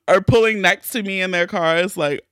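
usual speaking voice

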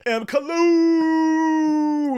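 slowly stretched "M Kalou", pitched high; drawn-out misreading. Retold or imitated. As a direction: drag out "M Kalou" as one slow high word. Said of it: "Every time I'm like".

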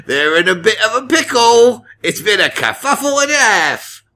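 put-on loud, high hammy Irish accent. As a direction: shout it in a bad Irish brogue. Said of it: "That was my Irish accent".

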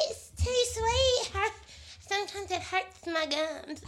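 high, wavering mock old-lady voice; mock old lady complaining, pitched high. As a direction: play a fussy little old lady. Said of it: "I sounded like an old lady".

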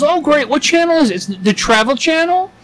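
very high hopeful mom voice; mock mom. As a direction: squeak it out, eager and naive. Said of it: "She goes".

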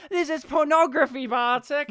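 loud, high, outraged protest; yelling in fake moral outrage at a friend; mock outrage. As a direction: shout it like a scandalized prude. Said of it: "me being like".

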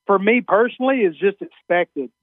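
ordinary delivery

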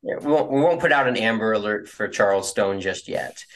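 ordinary talk, nothing marked

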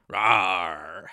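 playful monster growl; yeti roar. Pretend. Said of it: "real life often confused with a Yeti".

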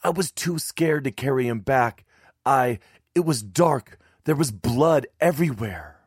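high, loud, panicked explanation; frightened, guilt-ridden outpouring; sputtering. A narrator's reading. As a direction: stumble through it in rising distress. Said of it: "I sputtered".

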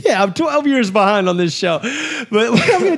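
laughing while admitting it. Real, not acted. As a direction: chuckle through the words. Laughing at being late.

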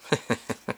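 soft, voiceless chuckle; quiet laugh. Real, not acted. Host amused by the story just read, quiet laugh. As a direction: laugh softly under your breath.

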